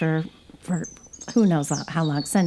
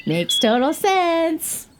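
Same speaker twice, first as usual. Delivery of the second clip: wry; 10 semitones higher, much slower than usual. Real, not acted. Mock approval, laughing.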